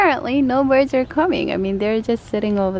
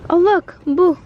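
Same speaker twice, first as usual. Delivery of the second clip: excited; 8 semitones higher, 8 dB louder than usual. Real, not acted. Mother excites toddler.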